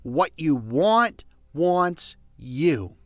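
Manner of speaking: What you want wants you spoken very slowly, each word weighed; slow emphatic. Shown as a demonstration. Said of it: "because it is so true".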